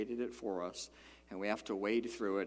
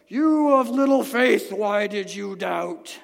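loud, stern, slow rebuke, higher; 9 semitones higher, 15 dB louder, slower than usual. Retold or imitated. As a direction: thunder it out as a harsh rebuke. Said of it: "How do you think that sounded when Jesus said it?".

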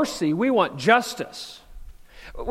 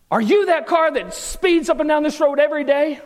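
accusing neighbor lady; 7 semitones higher, 5 dB louder than usual. Retold or imitated. Voicing the yelling neighbor lady.

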